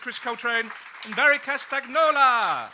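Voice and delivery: loud, high host announcement over applause; game show host calling the panel back on; announcer shout. Acted. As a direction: bellow it over the cheering crowd.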